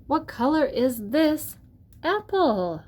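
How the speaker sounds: bright, lively picture-book reading; reading to a toddler. Acted. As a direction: ask the kids each question with wonder.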